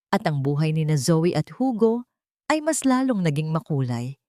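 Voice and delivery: plain reading voice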